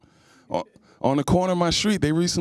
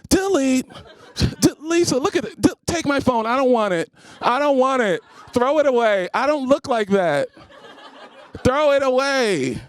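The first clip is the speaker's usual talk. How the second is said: mock horrified woman; 7 semitones higher than usual. Woman seeing her photo, hammed-up disgusted cries to delete it. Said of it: "All I hear is".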